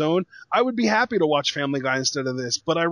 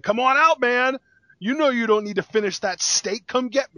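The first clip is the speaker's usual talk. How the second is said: higher-pitched mock wrestler challenge; taunting heel; 7 semitones higher than usual. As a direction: goad him like a heel calling out a rival. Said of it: "Cena would take the heel route and be like".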